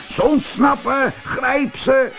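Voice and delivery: high-pitched acted alarm call. A narrator's reading. Soldiers alerting each other to an escape, high urgent call. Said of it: "riepen de soldaten".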